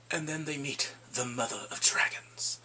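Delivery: hushed, breathy reveal; awed whisper; dramatic whisper. Real, not acted. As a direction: whisper it like a big secret.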